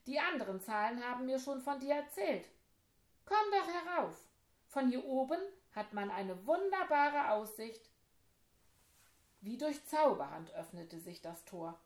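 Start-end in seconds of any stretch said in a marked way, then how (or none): 0.1-7.9 high, loud inviting call; eager call from above; friendly call. Acted. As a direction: wave them up from the tower top. Ten keeps calling down.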